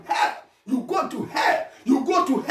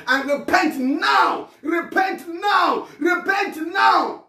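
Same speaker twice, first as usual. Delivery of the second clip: urgent preaching; 6 semitones higher, much slower than usual. Real, not acted. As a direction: stretch the words out and press the plea home. Urging listeners to repent immediately.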